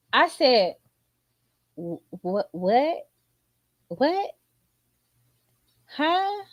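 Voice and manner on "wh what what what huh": stunned questions, rising in pitch; confused, spaced-out one-word questions that climb; baffled. Acted. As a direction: keep asking in growing disbelief. Stunned reply to child's logic.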